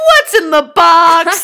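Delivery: slow, loud, strained shout of the line; movie-line impression. Cohost imitating the famous line, slow and loud. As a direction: belt it like the desperate movie scene. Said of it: "That's how he says it".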